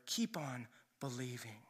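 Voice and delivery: soft, low encouragement; hushed, tender urging; near-whispered comfort; gentle reassurance. Retold or imitated. Jesus reassures, said another way.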